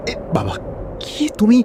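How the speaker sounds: normal talking voice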